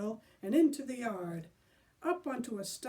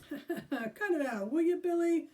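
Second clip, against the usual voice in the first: nervous chuckle; 7 semitones higher, 5 dB louder than usual. Acted. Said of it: "chuckling nervously".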